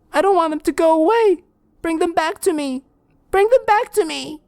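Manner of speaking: put-on very high, loud child screams; ghost children screaming. Dead children screaming at the detective, very high.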